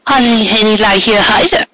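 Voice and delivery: high coy mare feigning a problem; high sing-song pretend worry; playful mock dismay. A narrator's reading. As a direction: tease him with a fake complaint, high. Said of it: "she intoned".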